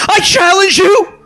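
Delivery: very high, squeaky shout; squeaky cracking voice. Acted. Young squire cries out from the knights, squeaky cracking shout. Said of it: "this voice cracks and says".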